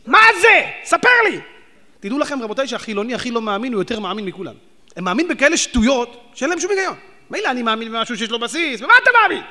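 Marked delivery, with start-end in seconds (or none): 0.1-1.4 much higher, louder demand; heated challenge to the secular listener; indignant shout. Real, not acted. Preacher mocking candle ritual. 8.8-9.4 much higher, louder question; heated challenge to a secular man; indignant challenge. Real, not acted. Preacher confronts secular.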